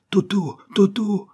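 high, loud cry of a name; desperate cry. A narrator's reading. Susan screams for Toto trapped in the fire. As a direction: scream his name into the flames. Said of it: "cria Susan".

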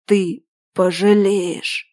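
slow, otherworldly spirit voice; eldritch horror. Acted. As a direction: drag out an unearthly threat. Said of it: "хриплым, потусторонним голосом выдал дух".

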